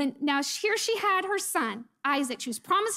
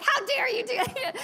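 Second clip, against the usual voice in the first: laughing mock outrage; 8 semitones higher than usual.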